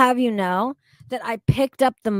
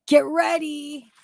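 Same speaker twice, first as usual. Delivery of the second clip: slower, higher "Get ready"; drawn out; 5 semitones higher, slower than usual. Shown as a demonstration. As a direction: stretch the words teasingly.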